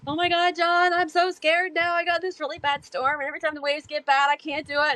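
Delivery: high, rushed, tearful panic; scared, near tears. Retold or imitated. Telling her mentor John how scared she is, high and tearful. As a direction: act out the scared younger self pouring it out. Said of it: "tearing up and everything".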